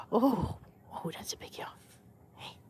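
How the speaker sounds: breathy whisper over a yawn. Real, not acted. Owner whispering to her yawning cat, soft whisper. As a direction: hush it so the cat stays calm.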